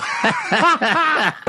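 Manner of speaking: high burst of laughter; laughing. Real, not acted. Laughing at heckler story.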